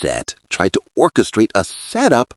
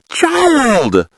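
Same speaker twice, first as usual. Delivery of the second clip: put-on "Child!" raised high, long falling; shocked exclamation; 8 semitones higher than usual. Vlogger reacting to the setup plot, shocked "Child!". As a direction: gasp it out at the news.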